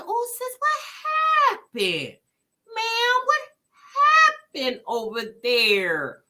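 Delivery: high, drawn-out mock disbelief; acted shocked questioning. Host replays her reaction to stranded sis, mock shocked. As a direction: play up the shock, stretching it high.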